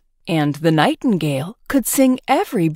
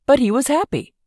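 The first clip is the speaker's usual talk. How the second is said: usual speaking voice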